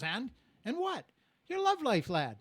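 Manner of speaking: high, loud, prying Irish voice; Irish leprechaun. Acted. Leprechaun prying into love life, high Irish voice. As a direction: press for gossip as an Irish leprechaun. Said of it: "the leprechaun pops out".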